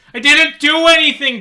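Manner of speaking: very high, loud pleading scream; desperate scream. Acted. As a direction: scream while dragged away. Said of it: "Gar two nine seven shouts".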